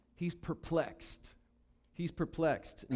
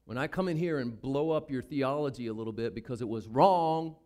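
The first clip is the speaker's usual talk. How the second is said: usual speaking voice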